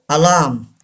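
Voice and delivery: alarm as "a-LAAM"; silent R. Shown as a demonstration. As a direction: say alarm with a long "aa" and no R. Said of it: "R is not pronounced".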